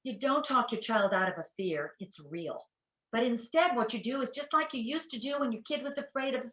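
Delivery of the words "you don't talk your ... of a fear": usual speaking voice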